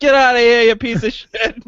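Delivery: hammed-up angry dismissal; mock rude villager telling him off; mock angry local. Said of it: "They're like".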